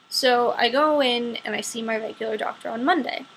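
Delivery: usual speaking voice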